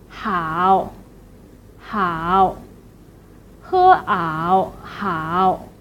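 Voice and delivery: "hao" dropped low then curved up, split as "he ao"; third tone. Shown as a demonstration. Teacher drills the word for good, low dipping "hao". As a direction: scoop down deep on "hao". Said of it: "low as you can and then bounce up".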